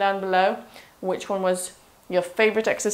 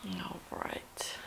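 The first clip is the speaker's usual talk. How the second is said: whispered; 19 dB quieter than usual. Real, not acted. Trainer pausing between exercises.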